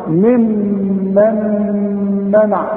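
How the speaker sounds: ممن منع with the meem and noon nasals held very long; long ghunna. Shown as a demonstration. Teacher makes the student hear the merged ghunna, very slow. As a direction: stretch the nasal hum through ممن منع. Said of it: "اسمع بقى".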